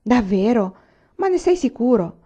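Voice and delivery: high, relieved disbelief; can't quite believe the good news; delighted surprise; amazed and relieved. A narrator's reading. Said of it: "tanto stupita e sollevata".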